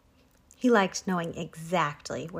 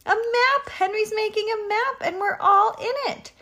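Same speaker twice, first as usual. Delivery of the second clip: very high, loud squawky uproar; excited exclaiming; 6 dB louder than usual. A narrator's reading. Animals in uproar at the chicken coop, exclaiming. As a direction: exclaim it at the top of your voice range. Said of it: "they exclaimed".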